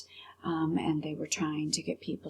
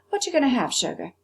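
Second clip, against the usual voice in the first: waitress; 7 semitones higher, faster than usual. Acted. Waitress voiced in story.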